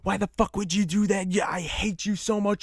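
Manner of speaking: high-pitched mock tirade, not fully shouted; retold scream. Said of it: "She starts screaming in the hall".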